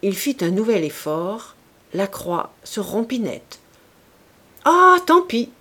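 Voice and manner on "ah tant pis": much higher, loud exasperated outburst; old man giving up on the broken cross; exclaiming. A narrator's reading. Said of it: "s'écria-t-il". (s'exclamer fort, aigu, agacé)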